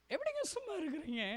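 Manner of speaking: high, soft, pleading question; tearful plea. Real, not acted. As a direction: ask it in a thin weepy voice. (கெஞ்சும் அழுகுரலில் மெதுவாக)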